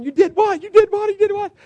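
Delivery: very high, loud, astonished; can't believe what you just heard; surprised. Retold or imitated. Pastor reacts to news.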